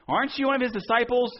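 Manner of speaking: high, pointed challenging question; accuser at fire. Acted. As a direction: poke at Peter with the question.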